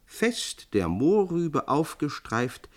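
usual speaking voice

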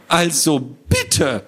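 high, drawn-out offended protest; indignant. Acted. Little man is called a gnome, indignant. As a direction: take great offence, slowly. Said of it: "empörte sich das Männchen".

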